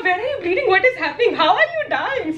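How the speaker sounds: high, laughing alarmed questions; hammed-up fright, stretching the last question; mock panic. Girl checking her bleeding friend, mock panic.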